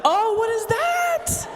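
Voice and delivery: high, drawn-out mock-horrified cry; alarmed outburst. Patient reacting to a misheard diagnosis, high mock alarm. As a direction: stretch it out high in exaggerated alarm.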